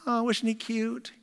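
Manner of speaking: put-on high, slow cooing over a baby; a slow, high baby-gushing coo. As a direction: gush sweetly like a doting visitor.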